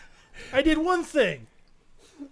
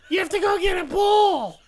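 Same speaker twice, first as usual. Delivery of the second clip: higher-pitched mother-in-law impression; scolding mother-in-law; 7 semitones higher, 4 dB louder than usual. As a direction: order him about like a nagging mother-in-law. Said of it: "that's a pretty good impression".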